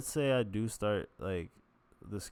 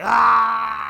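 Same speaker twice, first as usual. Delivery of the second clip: high loud yelp of icy-water shock; cold shock scream; 16 dB louder than usual. Retold or imitated. Stepping into cold shower.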